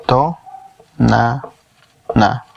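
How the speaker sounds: To, na, na split into slow separate syllables. Shown as a demonstration. Teacher breaking the word Tonana into syllables for students. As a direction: spell out To, na, na with pauses. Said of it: "Lo podemos juntar y lo podemos separar".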